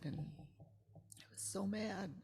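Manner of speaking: slow whispered admission of anger; hushed confession. Real, not acted. Confessing anger at God in a slow whisper.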